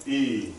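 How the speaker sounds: E held as a clear ee; E drawn out as one vowel; long E sound. Shown as a demonstration. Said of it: "say the sound out loud".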